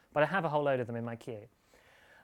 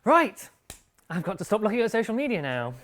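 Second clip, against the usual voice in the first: high, loud "right", then announce it; loud mock announcement; 5 semitones higher, 8 dB louder than usual. Host relaying producer's order to viewers, loud and high. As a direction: make a big show of obeying. Briskly obeys the producer aloud.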